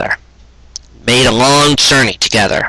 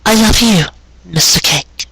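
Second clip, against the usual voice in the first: higher, lightly voiced loving wife; 8 semitones higher than usual. A narrator's reading. Wife answers her husband's love, higher and sweet.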